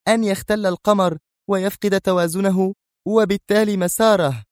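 high-pitched, strict explanation; stern. A narrator's reading. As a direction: answer without yielding, high and stern. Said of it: "أجابته بنفس الصرامة". (بصرامة)

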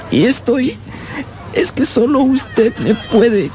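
put-on pleading child. The runaway boy begs his aunt for help, a touch tearful. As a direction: beg the aunt for help like a hurt little boy. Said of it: "No pude seguir... me puse a llorar".

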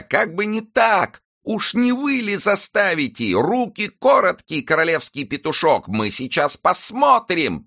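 high, slow scornful screech at the officer; mocking angry shriek. A narrator's reading. Nanette mocking the royal officer, high slow scornful screech. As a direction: taunt him from the top of your voice. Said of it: "кричала Нанетта".

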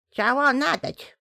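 toothless witch mumbling. Acted. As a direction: mumble it like a gummy old hag. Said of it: "шамкает ведьма".

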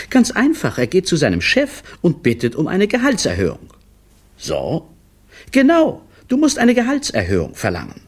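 high, quick reproachful voice; reproachful wife. A narrator's reading. Wife reproaches her husband over lunch excuses, high and quick. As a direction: throw his own words back at him.

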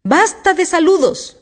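high, loud, drawn-out royal screech. A narrator's reading. Queen stops the bowing gardeners, screeching. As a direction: screech it to cut them off. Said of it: "chilló la reina".

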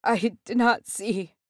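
broken pleading. A narrator's reading. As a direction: keep pleading, voice breaking. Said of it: "his voice broken".

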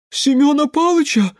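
very high, loud astonished cry. A narrator's reading. Elder's wife hears of her husband, astonished. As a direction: cry the name out in disbelief. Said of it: "раздался удивлённый голос".

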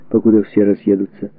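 ordinary talk

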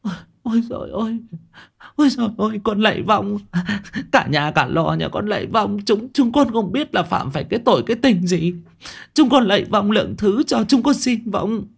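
high, tearful begging; whimpering plea. A narrator's reading. Kneeling wife begs the spirit for mercy, whimpering. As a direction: plead in a high, frightened whine. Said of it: "quỳ sụp xuống đất mà mếu máo".

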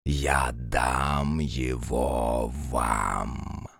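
vast, slow, deep cosmic voice; a huge dragon speaking slowly. Acted.

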